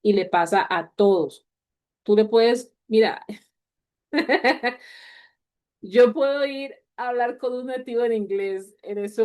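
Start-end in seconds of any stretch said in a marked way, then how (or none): 4.1-4.8 light amused chuckle; quick laugh before the example; short laugh. Real, not acted. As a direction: laugh at yourself briefly. Teacher laughs mid-explanation.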